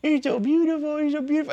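very high doting falsetto; cooing dog talk. Retold or imitated. Partner praising her dogs, high cooing. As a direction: imitate someone fussing over a pet.

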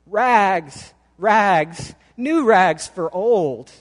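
loud ragman's call, drawn out; ringing peddler's cry; street cry. A narrator's reading. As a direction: call out your wares down the street. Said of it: "cried the sobbing, bleeding".

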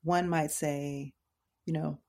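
normal talking voice

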